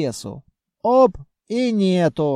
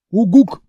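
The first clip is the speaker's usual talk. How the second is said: grunt; 4 semitones higher, 9 dB louder than usual. Acted. Character answers with a grunt.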